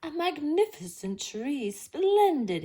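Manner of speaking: thrilled about the tree; delighted cry. A narrator's reading. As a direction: admire the tree out loud. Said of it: "he cried".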